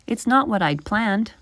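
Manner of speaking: plain reading voice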